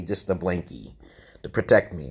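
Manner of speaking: ordinary talk, nothing marked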